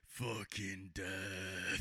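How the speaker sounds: very low, slow and hushed doom voice; put-on low voice of doom; mock doom voice. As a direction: drag it out deep and ominous. Acted quote in a slow low growl.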